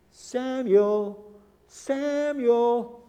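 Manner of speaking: put-on a voice calling a sleeping child. God calls the boy Samuel by name, calling out.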